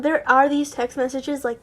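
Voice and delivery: ordinary delivery, nothing marked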